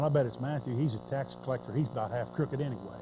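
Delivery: low, quiet sly gossip; a disciple quietly smearing the tax collector. Pretend. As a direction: mutter the rumour sideways. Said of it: "nobody sitting there going".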